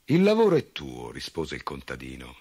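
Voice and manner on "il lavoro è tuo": farmer's voice. A narrator's reading.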